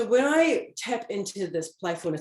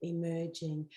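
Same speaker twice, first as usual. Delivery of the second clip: soft repeat; 7 dB quieter than usual. Shown as a demonstration. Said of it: "Look at that".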